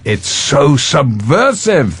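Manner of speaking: slow, drawn-out mock Italian accent on "subversive"; Italian journalists imitation. Imitating Italian journalists who saw the film, mock Italian accent. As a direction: stretch it like an impressed Italian journalist. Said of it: "they went".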